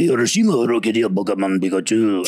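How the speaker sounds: guttural mock Japanese accent. Stand-up comic doing his accents bit to a podcast host, crude guttural mock Japanese accent. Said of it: "the guttural Japanese".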